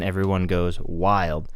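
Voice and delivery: ordinary delivery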